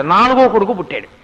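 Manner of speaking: usual speaking voice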